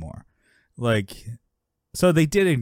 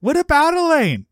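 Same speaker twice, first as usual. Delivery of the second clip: mock dad shout, very high and loud; 6 dB louder than usual. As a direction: blow their cover with a comic holler. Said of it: "just so loud".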